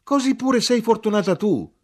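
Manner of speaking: high, loud triumphant cry; very high, full-voiced exclamation; shouted. A narrator's reading. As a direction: shout it with excited conviction. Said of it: "e io gridai".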